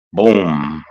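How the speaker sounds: ordinary talk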